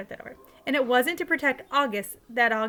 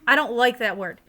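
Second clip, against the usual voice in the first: stern; 7 dB louder than usual. A narrator's reading. Mom scolding Jamie.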